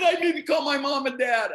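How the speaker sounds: high, loud retold excitement; overjoyed outburst. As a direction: blurt it out at the top of your range. Retells big-league news, bursting with joy.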